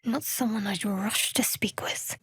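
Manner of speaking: soft private whisper; hushed aside. Acted. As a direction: whisper it to yourself. Muttered asides while exploring.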